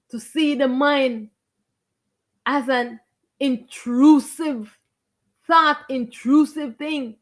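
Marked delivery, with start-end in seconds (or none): 3.4-7.2 slow and drawn out. Real, not acted. Stretching a key word.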